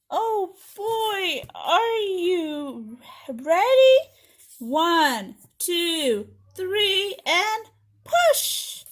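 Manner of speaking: drawn-out build, climbing to a high, loud finish; excited countdown. Acted. Teacher counts children in to push the button, building excitement. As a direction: wind the children up for the big push.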